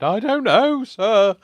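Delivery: high, loud sheepish pupil; schoolkid voice. Retold or imitated. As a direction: squeak it out like a caught schoolkid. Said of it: "I felt like I was whisked back to school then".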